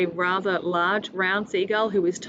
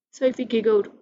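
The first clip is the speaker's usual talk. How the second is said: ordinary delivery, nothing marked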